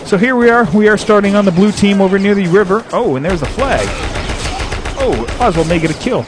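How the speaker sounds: lively game commentary, calling the action; play-by-play shoutcast. Acted. Host calling his own game for radio listeners, lively play-by-play. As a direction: narrate the match as it happens. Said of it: "play and shoutcast at the same time".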